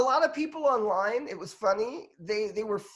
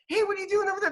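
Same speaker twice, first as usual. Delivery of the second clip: much higher, half-hearted mock yell at the family; mock stern call; 11 semitones higher, much faster than usual. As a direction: imitate himself calling across the house, pitched way up. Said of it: "I think I'm shouting at people without even shouting".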